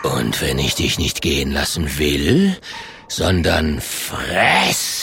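low, looming bear voice; threatening bear. Acted. The bear threatens to eat Klonk, threatening. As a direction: stretch the final word slowly into a rising menace.

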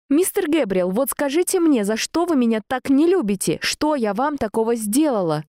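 high, indignant demand. A narrator's reading. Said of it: "Позволила я себе повысить голос".

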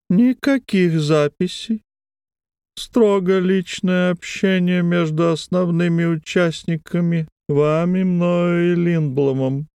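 a thin eerie wheedle; thin wheedling voice. A narrator's reading. Brose's thin high voice.

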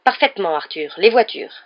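loud, firm confirmation; blazing indignation. A narrator's reading. Wife confronting her guilty husband, indignant. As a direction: throw it back at him forcefully. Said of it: "les yeux flamboyants".

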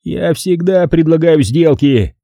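slow retort pitched high; irritable retort. A narrator's reading. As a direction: snap back, drawing the words out. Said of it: "огрызнулся он". (раздражённо огрызаясь, высоким голосом)

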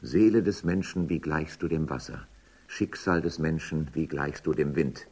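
recited verse. Acted. Quoting poetic lines mid-argument.

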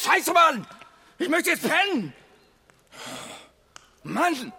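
viel höher und lauter, brüllend; wütender Schrei. Acted. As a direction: schreit seinen ganzen Frust heraus. Outburst after a fight over the key. (Mann brüllt nach dem Gerangel um den Schlüssel wütend los)

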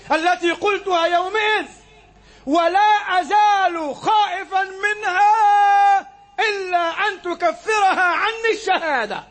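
high, slow, crying-out sermon voice; impassioned preaching. Acted. Preacher voicing Abu Hudhayfa's lifelong fear, high and slow. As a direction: plead it out high and drawn.

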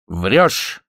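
usual speaking voice

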